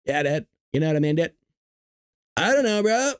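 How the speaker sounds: put-on SoCal dude accent, rising on the last phrase; Southern California surfer. Demonstrating the West Coast accent to viewers, California surfer accent. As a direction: parody a California surfer bro. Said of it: "we have our own accent over here".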